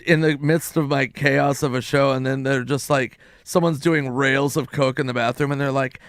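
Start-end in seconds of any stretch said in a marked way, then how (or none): none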